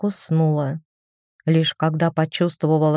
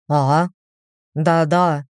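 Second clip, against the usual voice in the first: absent-minded. A narrator's reading. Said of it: "отстранённо ответил голем".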